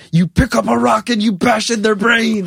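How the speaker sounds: dad losing it at the son who won't fight back, retold mock outburst; retold flip-out. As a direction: snap it out like a father losing his temper. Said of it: "my dad flipped out and went".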